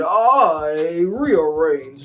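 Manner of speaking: slow, high, prim put-on voice; drawn-out superior matron, looking down; haughty mother-in-law.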